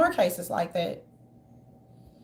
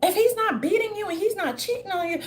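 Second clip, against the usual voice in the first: normal talking voice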